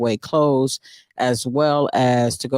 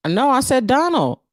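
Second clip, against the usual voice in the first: New York accent, higher and punchier; 9 semitones higher than usual. Shown as a demonstration. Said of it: "it's my New York accent".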